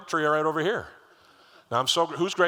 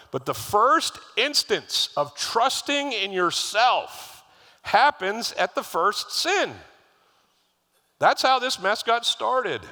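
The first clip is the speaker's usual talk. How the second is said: loud, high and slow, hammering each phrase; emphatic preaching; 9 semitones higher, 5 dB louder, much slower than usual. Acted. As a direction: build to a slow, forceful climax. Preacher driving home the point.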